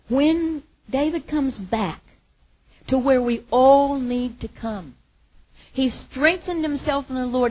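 loud, slow, high preaching; full-force preaching. Acted. Preacher to congregation, slow, loud, building. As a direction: drive it home from the pulpit.

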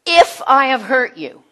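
loud, heavy emphasis on a non-apology; mock apology, loudly hedged; weak apology. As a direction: punch out a grudging conditional apology. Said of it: "this is how we do it".

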